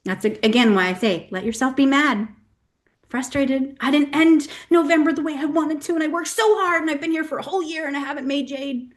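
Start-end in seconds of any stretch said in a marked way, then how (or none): 3.8-8.9 high, quick whiny complaint; mock complaint. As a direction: parody a frustrated member venting. Voicing a frustrated team member.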